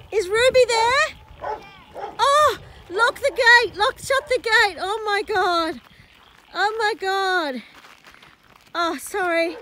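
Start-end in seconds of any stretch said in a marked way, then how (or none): none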